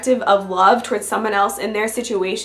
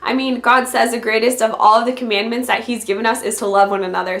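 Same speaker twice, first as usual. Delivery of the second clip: ordinary delivery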